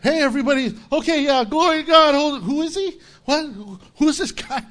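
high, loud, excited babble; hammed-up swept-along onlooker; lightly acted crowd frenzy; mock frenzied crowd member. Preacher acts out the frenzied crowd.

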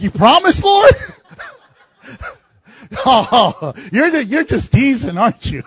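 mock dismay. Imagined reply to God, loud incredulous mock dismay. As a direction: play it as comic disbelief.

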